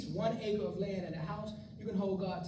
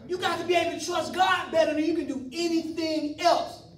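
preaching hard; 4 semitones higher, 5 dB louder than usual. Real, not acted. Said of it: "preaching at full force".

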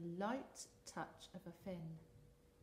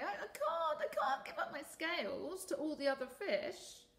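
upset fish voice; 9 semitones higher, 6 dB louder than usual. Acted. Rainbow fish refusing.

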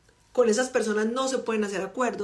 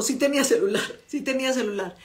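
ordinary talk

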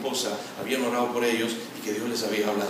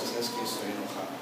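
calm admission; 5 semitones lower, 12 dB quieter than usual. Pretend. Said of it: "Puedes decirle a tu esposa".

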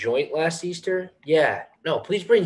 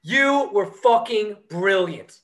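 slow, raised-pitch gush; emphatic praise; 5 semitones higher than usual. Acted. Musician praises the drummer after the gig, thrilled. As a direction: stretch it out in admiration.

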